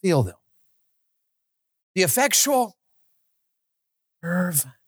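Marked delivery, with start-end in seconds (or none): 1.9-4.7 fervent. Shown as a demonstration. Preacher showing fervent feeling in the words. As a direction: stress effectual and fervent with feeling. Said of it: "Don't just say them, feel them".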